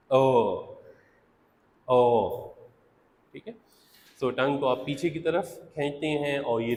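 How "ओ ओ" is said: ओ, a long middle vowel said on its own; vowel demo. Shown as a demonstration. Teacher sounds out the vowel for students. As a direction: hold the vowel ओ, tongue in the middle. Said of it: "So it's".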